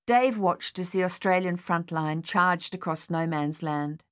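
plain reading voice

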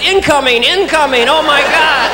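mock alarm. Comic voices two-year-old facing brother's outburst, mock alarm. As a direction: sound the alarm like a toddler under attack. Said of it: "The two-year-old just".

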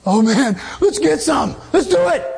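hyped-up, high-pitched eager cry; blurted, over-eager mock hype; mock excitement. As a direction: act out somebody pumped up and raring to go. Acts out people chasing worldly thrills.

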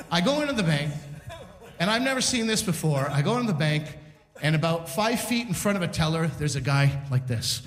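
ordinary talk

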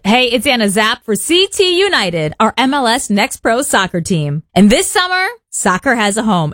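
upbeat, polished sponsor promo; radio ad read. Acted. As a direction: sell the local soccer team with energy. Host reads a soccer sponsor ad.

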